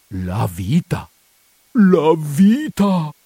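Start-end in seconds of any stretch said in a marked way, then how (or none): 1.7-3.1 higher, louder and very slow, drawn out in dread; anguished cry. Acted. Priest crying out to his housekeeper in dread. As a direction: wail it as if life hangs on it. Repeats the words, second cried out.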